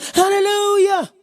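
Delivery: lightly camp it up, mocking; mock effeminate praise. Preacher mocking how some men worship, mock dainty hallelujah.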